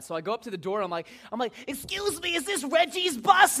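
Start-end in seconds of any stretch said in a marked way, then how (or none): 1.7-3.6 very high, slow silly voice; goofy joke voice. Retold or imitated. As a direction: ask it in a daft, drawn-out falsetto. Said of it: "goofing around".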